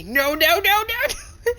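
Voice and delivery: high, loud panicked protest. Acted. Player panics as his power keeps climbing, high frantic protest. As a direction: beg it to stop in rising panic.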